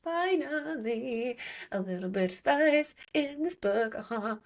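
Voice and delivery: high, drawn-out sing-song relief; savouring it in a high voice; gloating delight. Acted. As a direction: stretch it out with mock relish. Readers' relief at spicy scene.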